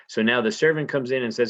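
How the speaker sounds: ordinary talk, nothing marked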